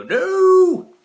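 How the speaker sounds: huge, high yelled no; shouted no. Pretend. Customer refusing help as he walks in, shouting. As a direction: blurt it out like a lunatic.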